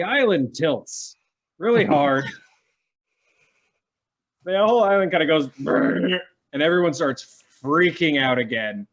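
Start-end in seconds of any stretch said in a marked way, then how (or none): 4.5-6.2 fast, higher, louder rumble sound; playful mock rumble; rumbling noise. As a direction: make the island shudder. GM imitates island rumble.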